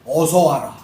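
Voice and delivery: low welcoming voice of God; God's welcome. Acted. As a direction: greet him home gently as God. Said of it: "하나님이 스데반에게 이야기합니다".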